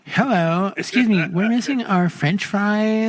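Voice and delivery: high complaining customer voice; hammed-up mom, last words drawn out; soccer mom. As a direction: play a put-out mom at the drive-through. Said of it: "like a soccer mom right now".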